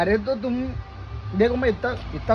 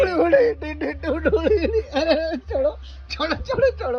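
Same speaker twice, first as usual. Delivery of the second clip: frantic high cries of let go; pained yelps; 7 semitones higher than usual. Acted. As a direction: squeal in pain as your hand is twisted. Yelping as hand is grabbed.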